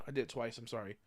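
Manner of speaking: ordinary talk, nothing marked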